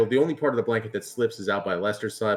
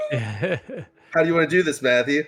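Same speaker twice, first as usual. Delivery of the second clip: high, loud showman's question; hammed-up big call; 9 semitones higher, 8 dB louder than usual. Game master hams up a big moment.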